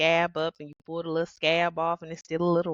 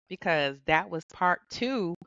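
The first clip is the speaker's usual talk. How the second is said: normal talking voice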